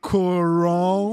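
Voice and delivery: Coron drawn out slowly, rising; mock slow name. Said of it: "que deve ter falado assim".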